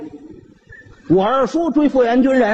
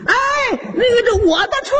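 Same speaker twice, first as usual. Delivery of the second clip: indignant shout; faster than usual. Acted. The uncle, hugging the watermelon, shouts that the bike is his. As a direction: yell it in a hurry, outraged.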